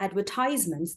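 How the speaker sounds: "advertisements" stressed on the long "tise"; American stress on TISE. Shown as a demonstration. Teacher explaining American English, slow "advertisements" stressed on "tise". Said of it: "the stress is on ties".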